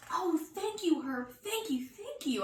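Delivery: high, gushing thanks; gushing happy. Acted. Girl thanks friend for half a cookie, gushing. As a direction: pour out the thank yous. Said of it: "I gushed real happy".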